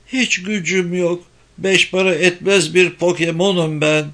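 put-on a small boy giving up; despairing child. A narrator's reading. Nike voiced, despairing child.

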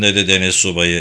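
usual speaking voice

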